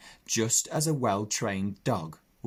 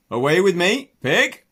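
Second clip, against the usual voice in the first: loud, higher farmer's shout to the pig; called command; 9 semitones higher, 11 dB louder than usual. Acted. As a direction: call the pig out across the field. Hoggett's held-breath command.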